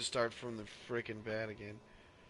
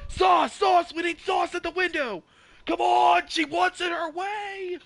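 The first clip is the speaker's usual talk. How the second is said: much higher, much louder yelling; frantic shout; 13 dB louder than usual. Acted. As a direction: holler for sauce at the window. Drive-thru order bit.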